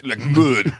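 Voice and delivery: put-on light French accent echo. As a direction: repeat it like a Frenchman. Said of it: "Why do we keep going French?".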